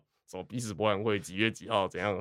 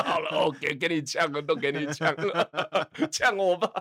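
laughing surrender; 5 semitones higher than usual. Retold or imitated. Retold giving in to teasing.

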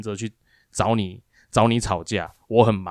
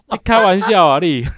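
higher and louder, still laughing; laughing disbelief; 7 semitones higher, 5 dB louder than usual. Real, not acted. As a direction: scoff through the laugh. (边笑边说不可置信)

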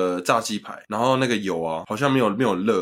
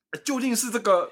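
much higher, put-on, laughing; 杨凡 impression; 12 semitones higher than usual. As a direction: mimic 杨凡 with a giggle in it. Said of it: "你要学杨凡吧".

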